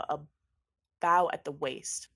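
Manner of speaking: usual speaking voice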